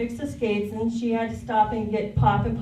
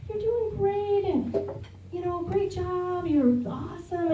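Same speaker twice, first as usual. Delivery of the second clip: encouraging cheer; 6 semitones higher, 4 dB quieter than usual. Retold or imitated. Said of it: "they're just like".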